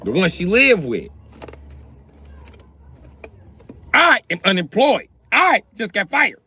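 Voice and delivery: mildly heated griping; annoyed rant. Acted. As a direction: complain loudly. [name] ranting.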